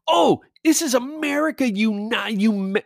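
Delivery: high mocking whine; mock shrill woman. Mimicking the woman insisting this is America, a high whine. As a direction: parody her outrage. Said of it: "she kept saying".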